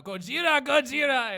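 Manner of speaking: loud, high-pitched yell. Retold or imitated. Recounting a hallway prank, yelled. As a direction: yell it out in alarm, full volume. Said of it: "I banged on the lockers and yelled".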